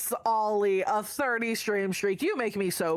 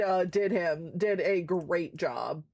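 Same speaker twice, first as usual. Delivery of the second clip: usual speaking voice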